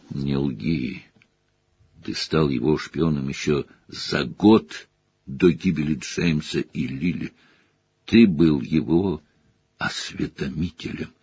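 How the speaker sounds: low and quiet, cold contempt; cold Sirius. A narrator's reading. Sirius condemns the traitor, low and cold. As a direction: press it down, low and icy.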